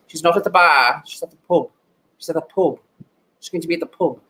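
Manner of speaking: put-on British-Irish pub accent. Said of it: "read this in a British accent or".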